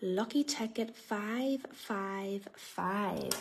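slow drawn-out number call; bingo caller. Real, not acted. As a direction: call the numbers like a raffle host. Live raffle draw number call.

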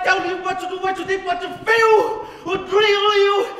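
impassioned shouting, pitched high; roaring appeal to the troops; ranting dictator speech. Acted.

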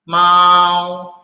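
MAU on a high, level tone; nada satu. Shown as a demonstration. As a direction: hold "Mau" long, high and flat. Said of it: "ini ada satu ya". ("Mau" nada satu, tinggi, datar dan panjang)

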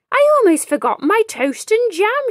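loud, high burst of remembering; sudden alarm. Acted. Madame Miraculous leaping from her chair, panicked and loud. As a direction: blurt it out in a panic.

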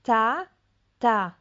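"Ta, ta" said as two separate slow syllables; split into syllables. Shown as a demonstration. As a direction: sound out each syllable with a pause. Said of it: "Posłuchaj". (powoli, sylaba po sylabie)

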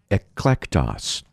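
eklektos with a slight stress on its opening "ek"; first-syllable emphasis. Shown as a demonstration. Preacher has listeners hear the Greek words, first-syllable emphasis on eklektos. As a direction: say eklektos slowly, leaning a little on the first syllable. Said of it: "pronounce them with a bit of an emphasis on the first syllable".

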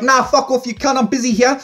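a hint of an annoyed, busy cat.

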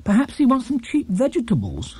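Mrs Brown voice. A narrator's reading. Mrs Brown answering the housekeeper, lightly voiced. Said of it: "said Missus Brown".

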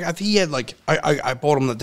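usual speaking voice

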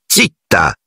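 a cold, clipped-off command kept understated; lightly voiced icy teacher. A narrator's reading. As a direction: shut her down coldly. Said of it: "disse Piton gelido".

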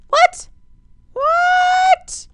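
very high, loud disbelieving shriek; shrieked what. Real, not acted. Shocked by sudden wall.

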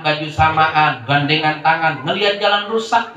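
ordinary talk, nothing marked

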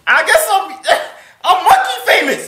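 high, loud and laughing; loud laughing squeal. Real, not acted. Joking loud high outburst on call.

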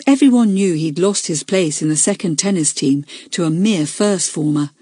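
plain reading voice

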